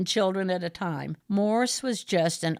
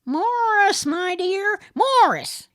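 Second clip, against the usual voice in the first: much higher, loud playful scolding; wagging correction; 13 semitones higher, 6 dB louder, slower than usual. A narrator's reading. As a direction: correct her like a naughty pupil. Morris voiced by narrator.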